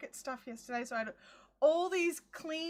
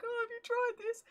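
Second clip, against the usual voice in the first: much higher, bright asking voice; retold question to people; 9 semitones higher than usual. Calling out the window to her family, a much higher asking voice. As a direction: pitch it up, talking through the window.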